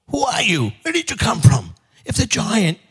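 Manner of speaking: high-pitched alarmed lady; startled questioning. Acted. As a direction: challenge a stranger in a high, startled voice. Startled lady questions the stranger Jack.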